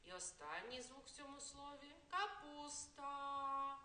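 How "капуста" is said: Капуста stretched very slowly to the last sound. Shown as a demonstration. Teacher reaching the last sound for the kids, very slowly. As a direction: linger on the final А of капуста.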